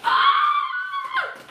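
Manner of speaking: very high, loud shriek of joy; excited squeal. Real, not acted. Spots the wished-for plant, squealing. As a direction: let out a real, piercing squeal.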